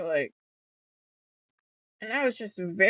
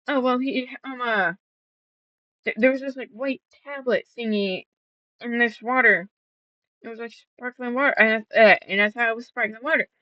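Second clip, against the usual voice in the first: mocking her excuses; 5 semitones higher, 4 dB louder, faster than usual. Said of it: "she's like".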